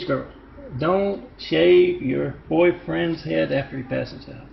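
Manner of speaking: ordinary talk, nothing marked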